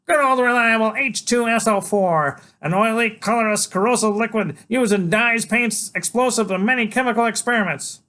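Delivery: put-on high, loud little boy reciting like a textbook; a kid lecturing the villain; know-it-all kid. Freak lecturing Killer Kane about acid, high and loud. Child voice.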